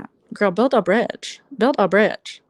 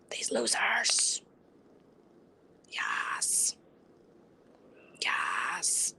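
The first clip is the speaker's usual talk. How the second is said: whisper; 5 semitones lower, 11 dB quieter, much slower than usual. Acted. Mock whisper repeating the misspelling.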